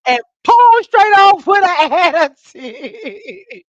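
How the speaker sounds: laughing through the words, high and loud; cracking up as she tells it. Real, not acted. Laughing while telling friends the story.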